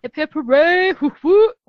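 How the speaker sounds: loud high cheering; hooray cheer. Pretend. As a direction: whoop it up like a crowd. Cohost joins cheering.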